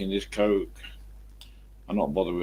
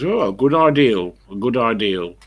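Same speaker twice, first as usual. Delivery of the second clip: loud, raised Somerset "ideal"; Somerset accent; 2 semitones higher, 7 dB louder than usual. Retold or imitated. Boss approving the work on the truck, Somerset accent. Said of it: "the Somerset people say that".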